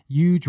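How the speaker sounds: "huge" said as a drawn-out "yooj"; Long Island "huge" without its H. Real, not acted. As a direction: drop the H in "huge", starting on Y. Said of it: "I just say huge".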